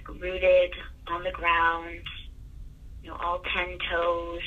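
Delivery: guided meditation. Acted. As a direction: guide them gently into calm breathing. Leads a breathing exercise.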